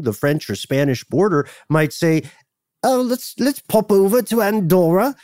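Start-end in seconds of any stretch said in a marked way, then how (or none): 2.8-5.1 high-pitched put-on British accent. Host voicing cross-border shoppers, silly British accent. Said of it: "why they have a British accent".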